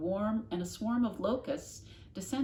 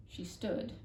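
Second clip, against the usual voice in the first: usual speaking voice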